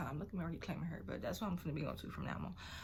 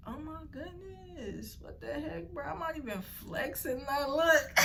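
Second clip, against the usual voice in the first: high, slow, squealing disbelief; amazed squeal; 9 semitones higher, 3 dB louder, slower than usual. Real, not acted. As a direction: gasp at your own strength. Shocked at own flexing.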